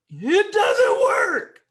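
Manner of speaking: high, loud, very slow sob-shout; overacted tearful outcry; mock crying wail. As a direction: wail it like a hammed-up breakdown. Acted server breaking down.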